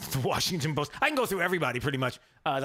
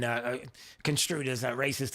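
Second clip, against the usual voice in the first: usual speaking voice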